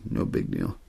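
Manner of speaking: ordinary talk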